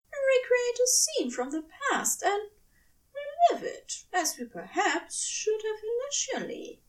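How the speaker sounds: high, hushed devoted angel voice; tender angel. A narrator's reading. Angel vowing to his lover, high and hushed.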